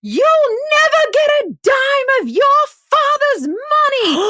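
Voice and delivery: high, drawn-out Southern-matriarch shout; hammed-up melodramatic fury, stretched and shrieked high; full Steel Magnolias. Mama Bear going off on her son, mock Steel Magnolias shouting. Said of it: "She goes full steel magnolias".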